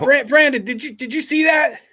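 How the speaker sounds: high-pitched, stumbling alarm; frightened stammer. Acted. Unnamed kid calling to Brandon, frightened stammer. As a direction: stammer the question high, as if spooked.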